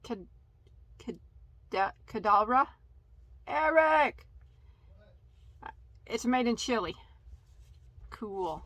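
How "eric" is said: raised, higher call for someone. Real, not acted. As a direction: call out for help from another room. Calling Eric over for help.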